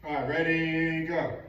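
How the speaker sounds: loud, drawn-out "ready" into go; calling the start. Real, not acted.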